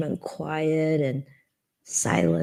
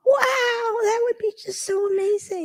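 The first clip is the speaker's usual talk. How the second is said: high, loud, overcome "wow"; emotional wonder; 4 dB louder than usual. Acted. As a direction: let amazement burst out, voice soaring. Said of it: "a part of me is saying".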